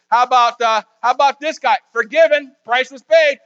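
escalating mock accuser; accuser Satan. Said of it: "He says".

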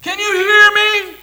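far-off yell, very high and loud; hollering. Retold or imitated. Rescuer hollering down the mine to trapped miners. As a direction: call down into the mine. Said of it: "Someone hollered out".

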